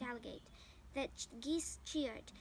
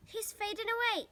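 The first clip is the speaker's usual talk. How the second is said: high, loud frightened cry; alarmed cry; 8 semitones higher, 6 dB louder than usual. A narrator's reading. Fern sees Wilbur fainting, high loud alarmed cry. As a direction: call it out in panic. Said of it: "screamed Fern".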